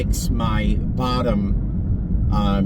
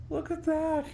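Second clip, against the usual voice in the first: high soft cooing; 9 dB quieter than usual. Real, not acted. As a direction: coo softly at a pet. Cooing at a pet.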